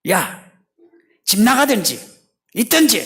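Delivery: loud, high-pitched ultimatum from a father; father scolding son. Acted. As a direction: snap an ultimatum at your son. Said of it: "아버지와 아들 간의 말에서".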